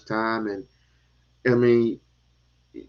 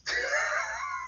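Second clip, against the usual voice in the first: short high-pitched laugh; 10 dB quieter than usual. Real, not acted. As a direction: burst into a quick high laugh. Laughs at own remark.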